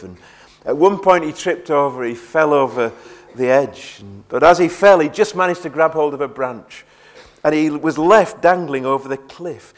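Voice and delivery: ordinary talk, nothing marked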